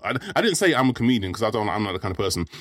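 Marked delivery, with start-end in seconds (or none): none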